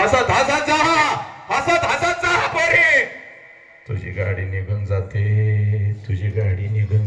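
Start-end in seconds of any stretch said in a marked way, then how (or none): 0.0-3.0 soar and hold the last word; high oratorical call; impassioned send-off. Acted. Father's farewell cried out.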